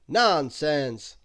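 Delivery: Mister Strong, reassuring the others, in a strong brave voice. A narrator's reading. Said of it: "in a strong, brave voice".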